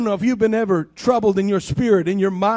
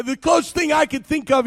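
ordinary talk

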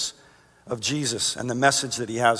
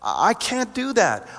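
slow, much higher protest; high-pitched protest; 8 semitones higher, slower than usual. Acted. Believer answering God's assignment, protesting in a high, slow voice. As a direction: draw it out high, as if the task is impossible.

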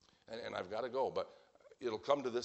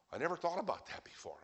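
taken aback; 7 semitones higher, faster than usual. Acted. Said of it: "I've heard people say that".

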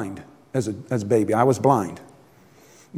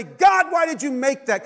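complaining to God; 8 semitones higher than usual. Pretend. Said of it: "get mad at God and say".

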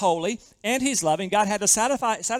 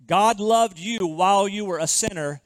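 ordinary delivery

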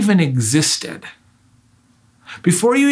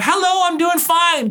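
high, cheerful mock answer; happy reply; 11 semitones higher than usual. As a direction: answer brightly, mirroring the greeting. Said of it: "respond in a happy kind of".

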